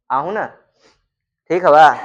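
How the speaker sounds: ordinary delivery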